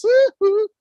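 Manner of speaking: loud, high "woo-hoo"; concert-crowd whoop. Pretend. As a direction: cheer it in falsetto. Mock singalong cheer.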